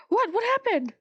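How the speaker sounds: high, alarmed question. Acted. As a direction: ask in sudden alarm. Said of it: "I'm like".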